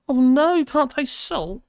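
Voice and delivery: gasp, then a high, loud, hammed-up oh no; over-the-top pretend dismay, voice jumping high; mock shock. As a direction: play up horror at the comment, slowly. Mock shock at chat comment.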